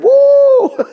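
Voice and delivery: a sudden high, loud woo of delight; joyful whoop. Pretend. Pastor mimicking a believer on a high, joyful whoop. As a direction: cheer like the top of a roller coaster. Highs of a roller coaster faith.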